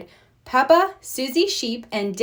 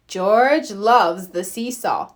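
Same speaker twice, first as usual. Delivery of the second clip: ordinary delivery, nothing marked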